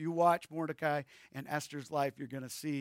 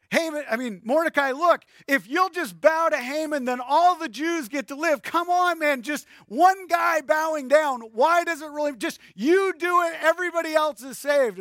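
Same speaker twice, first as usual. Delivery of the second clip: loud, high, insistent plea; exasperated pleading; 8 semitones higher, 7 dB louder than usual. Acted. Congregation urging Mordecai to just bow down, exasperated pleading. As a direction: plead with him at full volume to give in.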